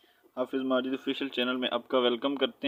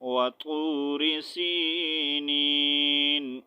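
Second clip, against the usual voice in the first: slow melodic tajweed recitation; Quran recitation; much slower than usual. Shown as a demonstration. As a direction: draw out سِينِينَ and stop. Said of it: "next". (آہستہ ترتیل سے تلاوت)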